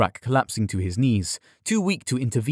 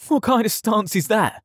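high, incredulous jeer; mocking question; 11 semitones higher than usual. A narrator's reading. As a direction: jeer it like a cheeky stable boy. Stable boy mocks Yared's stance.

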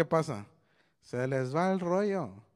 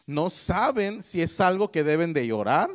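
normal talking voice

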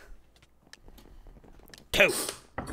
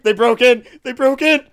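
high, loud alarm; frantic high shout; panicked yelling; 8 semitones higher, 11 dB louder than usual. Real, not acted. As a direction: scream the warning in a panic. Hiding spot invaded in game.

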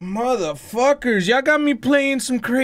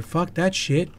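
usual speaking voice

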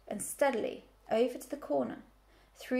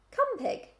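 lightly voiced farmer calling an animal, high; farmer's command; 12 semitones higher than usual. A narrator's reading. Farmer tries commanding the pig, light high call. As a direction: give a high, short call.

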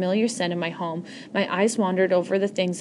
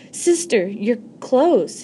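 ordinary delivery

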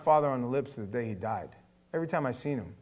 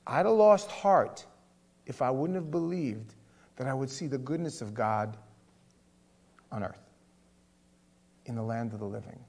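scripture reading; 2 semitones lower than usual. Acted. Reading the psalm.

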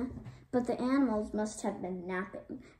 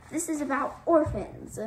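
higher, louder yell; 6 semitones higher, 5 dB louder than usual. A narrator's reading. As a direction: shout it back stubbornly. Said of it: "yelled Katie".

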